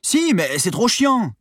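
high, loud, drawn-out whine; whining protest. A narrator's reading. Reluctant sailor answering Stéphanie, whining. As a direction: complain slowly and loudly. Armand whines back at the monitors.

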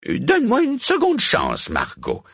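high-pitched plea, dropping on the name; pleading witch. A narrator's reading. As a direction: beg your rival for another go. Losing witch begs her rival.

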